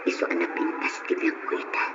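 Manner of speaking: hushed, breathy confiding whisper; whispering her private news to Noel. Acted. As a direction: drop to a secret whisper. Confides softly about her life.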